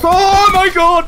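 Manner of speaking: high, loud, drawn-out shock; shocked shriek. Real, not acted. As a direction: cry out in sudden surprise. Sudden shock in game.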